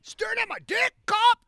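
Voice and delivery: jokingly voicing what Brian might yell at the cop; mock yell. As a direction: shout the taunt like a drunk.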